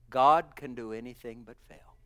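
ordinary delivery

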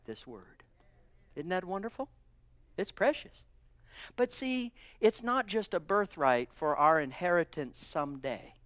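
ordinary delivery

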